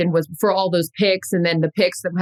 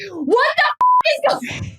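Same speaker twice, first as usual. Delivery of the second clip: high, drawn-out shout; sudden hammed-up yell of disbelief; mock outburst; slower than usual. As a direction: lose your cool mid-promise. Mock outburst breaks calm promise.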